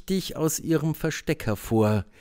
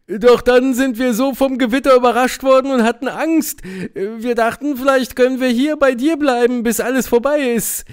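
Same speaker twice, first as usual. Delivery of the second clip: owl voice; 10 semitones higher, 4 dB louder than usual. Acted. Owl voice telling.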